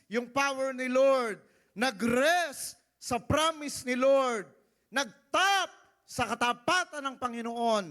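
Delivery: high, loud, slow preaching; preacher exhorting the congregation about Sarah; preaching at full force. Acted. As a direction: drive the point home to the church.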